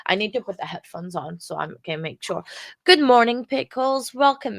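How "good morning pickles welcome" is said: higher, louder good morning; upbeat stream hello; bright greeting. Real, not acted. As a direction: call out a cheery hello to the chat.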